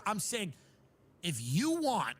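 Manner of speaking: ordinary talk, nothing marked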